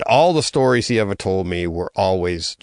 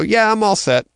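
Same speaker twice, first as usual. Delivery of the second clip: breezy dismissal; 12 semitones higher, 3 dB louder than usual. Retold or imitated. Tells recruiter he's done.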